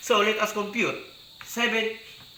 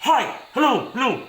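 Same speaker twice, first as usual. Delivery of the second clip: raised, higher "Hi, hello, hello" called out; demo shout; 4 semitones higher than usual. Shown as a demonstration. Teacher shouting into the mic to show amplitude, raised voice. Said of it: "When you shout".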